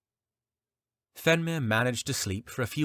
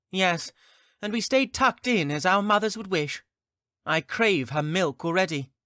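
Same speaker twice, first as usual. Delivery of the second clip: put-on high, snarky kid voice; sarcastic child voice; 11 semitones higher, 4 dB louder than usual. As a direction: bite back like a mocking youngling. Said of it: "bit back with his characteristic wit".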